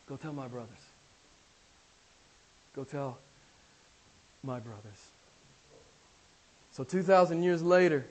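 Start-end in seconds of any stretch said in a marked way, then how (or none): 0.1-5.1 low, soft, spaced-out words; hushed command. Acted. Jesus sending Mary to his brothers, hushed command. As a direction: give the instruction quietly, with long pauses.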